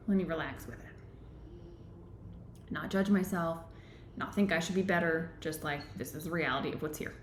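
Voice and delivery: soft, settling inner voice; calm self-talk. Acted. Teacher modeling relaxing with a feeling, calm.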